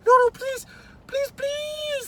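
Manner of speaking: high, loud desperate pleading; begging. Acted. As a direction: beg the owl not to punish her. Maria begs the owl.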